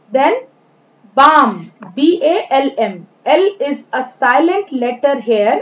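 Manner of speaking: normal talking voice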